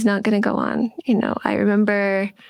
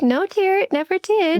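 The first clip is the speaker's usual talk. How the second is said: higher, lightly voiced cheerful mother; a light take on her breezy mom; mock chirpy mother voice; imitating mom; 9 semitones higher than usual. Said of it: "And she's like".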